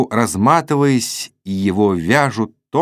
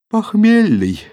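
much higher, loud yawny word; yawning; 13 semitones higher, 5 dB louder, slower than usual. A narrator's reading. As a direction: answer through a yawn. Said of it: "зевнув, ответила Саша". (зевая, высоким голосом)

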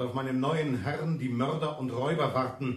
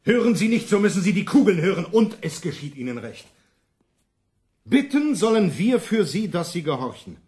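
angry threat; 4 semitones higher, 6 dB louder than usual. Acted. Envoy threatens peasants with bullets.

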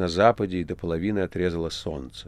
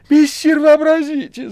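very high, loud, bursting with glee; joyful excited cry; 9 dB louder than usual. A narrator's reading. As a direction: shout the news in delight. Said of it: "закричал возбуждённый радостно". (радостный возбуждённый крик)

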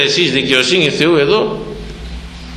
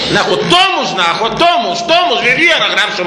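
impassioned exclaim; 7 semitones higher than usual. Real, not acted. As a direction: throw it up in wonder. Preacher exclaims at the thief's wisdom.

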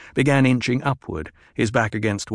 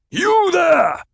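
very high, loud shout; roaring; 7 dB louder than usual. Acted. Chieftain roars an order to his hidden man. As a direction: bark the order across the basin. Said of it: "Stilgar roared".

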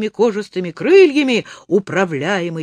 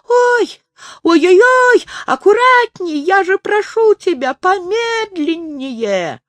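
very high, loud squeal of panic; shrieky, hammed-up terror; frightened pleading; 11 semitones higher, 5 dB louder than usual. A narrator's reading. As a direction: wail and beg while hanging on, stretching the end. Dangling in fright from flying friend.

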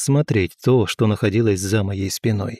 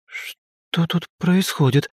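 higher, slow, hesitant question; uncertain girl's voice; 5 semitones higher, much slower than usual. A narrator's reading. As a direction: ask it hesitantly, drawn out and higher. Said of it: "раздался неуверенный голос девушки". (неуверенный девичий голос)

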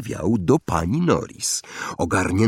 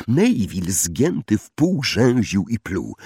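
ordinary delivery

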